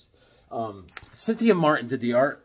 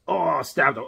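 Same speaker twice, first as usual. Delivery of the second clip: ordinary talk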